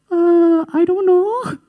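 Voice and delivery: tiny high squeak of a voice; timid little mouse. Retold or imitated. Bullied boy answering his bully, squeaky and timid. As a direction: play a cowed kid, very high.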